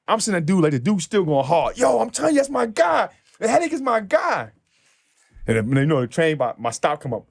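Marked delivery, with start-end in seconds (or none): 1.8-4.5 high insistent mock voice; imitating the fan. Fan insisting to Will, high mock insistence.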